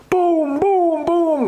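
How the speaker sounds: high, booming gunfire imitation; imitated gunshots. Golfers suddenly hear shots at the hole, imitated gunfire.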